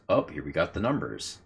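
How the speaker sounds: usual speaking voice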